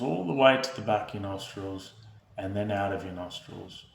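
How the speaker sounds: soft, unhurried meditation voice with long pauses; guided meditation. Real, not acted. Host leading viewers through breath and body awareness, calm guided meditation. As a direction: guide them gently into stillness, hushed and even.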